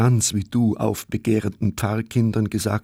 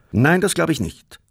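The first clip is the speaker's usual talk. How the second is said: hearty; 8 semitones higher than usual. A narrator's reading. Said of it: "sagte Sepp herzhaft".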